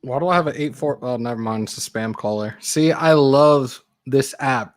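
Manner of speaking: ordinary talk, nothing marked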